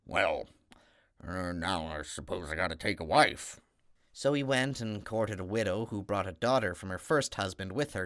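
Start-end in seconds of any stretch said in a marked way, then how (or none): 0.0-3.6 lower, unhurried father voice; father's voice. Acted. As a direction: settle into an old father's resigned tone. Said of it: "Then he said".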